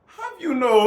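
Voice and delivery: very high, loud Jamaican imitation; mock Jamaican accent. Mocking what she was like, Jamaican accent breaking into laughter. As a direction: squeal out a Jamaican accent, cracking up. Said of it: "like, a Jamaican accent".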